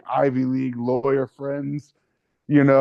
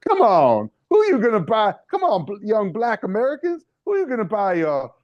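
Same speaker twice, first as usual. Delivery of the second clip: high, sing-song ad pitch voice; hamming up a folksy fried-chicken saleswoman; mock Popeyes ad woman; 7 semitones higher than usual. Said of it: "this woman who reminds you of, like, those racist Popeyes commercials".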